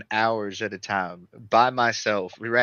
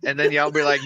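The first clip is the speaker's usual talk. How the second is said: ordinary talk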